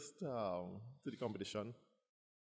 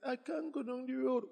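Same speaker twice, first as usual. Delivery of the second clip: mock worried whine; 11 semitones higher, 3 dB louder than usual. His own worried voice retold.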